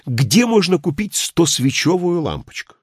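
high, urgent question; exclaimed question. A narrator's reading. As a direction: burst out asking, pitched well up. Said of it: "воскликнул вдруг гражданин". (вопрос-восклицание)

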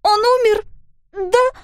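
put-on high, loud, scared child asking; a girl begging her stepmother to tell her the truth; frightened girl. A narrator's reading. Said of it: "спрашивала Пиппа". (высокий испуганный детский голос)

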